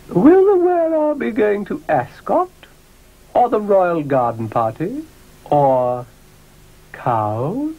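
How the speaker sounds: high-pitched talking hat. Acted. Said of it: "Another hat tried".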